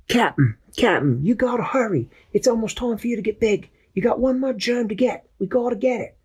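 high squeaky sidekick voice; Peso's high voice. Acted. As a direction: chirp it like an eager little helper. Peso urges hurry.

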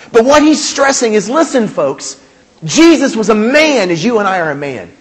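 ordinary talk, nothing marked

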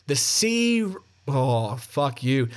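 normal talking voice

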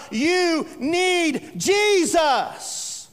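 drawn-out high shouted plea; crying out. Acted. The Holy Spirit crying out, slow shouted plea. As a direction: call out slowly like a voice pleading. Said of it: "he's crying out".

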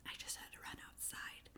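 whispering out of breath; panting whisper after running downstairs. Real, not acted. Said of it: "I'm a bit out of breath".

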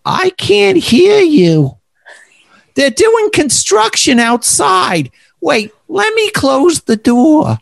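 put-on high, slow, drawn-out elderly voice; stretched, high-pitched old-timer; old man on the phone. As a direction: play a hard-of-hearing old man calling out. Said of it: "And he goes".

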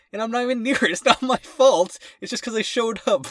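high, loud rising exasperation; venting, voice climbing high; exasperated rant. Real, not acted. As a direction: pitch way up in disbelief. Ranting about shift breakdowns.

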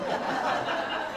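a quick, airy chuckle at himself; short breathy laugh. Real, not acted. Laughing to his wife about the odd nudge, short airy chuckle. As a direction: laugh it off, a bit embarrassed.

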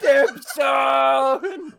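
screaming. Retold or imitated. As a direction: belt out "Dip zone" like the drunk friend did. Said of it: "He was screaming".

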